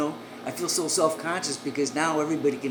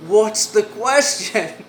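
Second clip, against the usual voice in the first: drawn-out question; 5 semitones higher, 9 dB louder, slower than usual. Retold or imitated. Voicing madam slowly.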